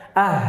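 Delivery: AH with the H let stream out; flowing final H. Shown as a demonstration. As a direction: let "Ah" run on into breath. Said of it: "Perhatikan coba".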